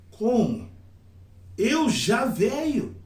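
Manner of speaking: "Eu já velho" stretched slow in high waves; high, swooping "Como? Eu já velho?"; questioning intonation. Shown as a demonstration. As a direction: drag out "Eu já velho" with exaggerated rises and falls. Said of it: "a entonação".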